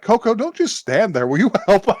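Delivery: lightly voiced impatient mom calling; scolding mom. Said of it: "her mom is ... just like".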